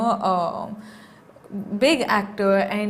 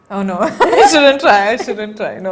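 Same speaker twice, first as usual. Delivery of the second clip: embarrassed laugh; 11 dB louder than usual. Real, not acted. Refusing the host's request to speak Urdu, laughing.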